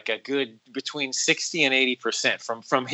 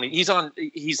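ordinary talk

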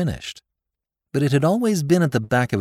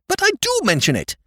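high, shrill insistence; shrill protest. Acted. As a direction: squeal the protest. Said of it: "protested his lordship shrilly".

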